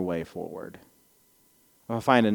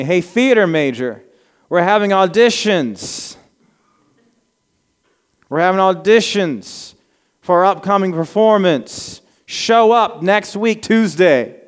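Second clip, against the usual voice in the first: higher and louder, eager; excited invitation; 7 semitones higher, 6 dB louder than usual. Retold or imitated. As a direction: call out to the theater major with enthusiasm. Courtney invites him.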